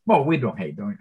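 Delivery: ordinary delivery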